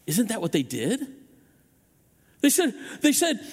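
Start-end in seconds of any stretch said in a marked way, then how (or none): none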